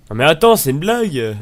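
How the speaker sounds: loud, high-pitched protest; exasperated outburst at the game; indignant disbelief. Real, not acted. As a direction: cry out as if it can't be true.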